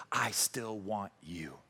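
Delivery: low, soft and very slow; hushed, slow declaration of love; tender acceptance. Acted. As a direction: let each word land gently, reassuring. God accepting the sinner, voiced.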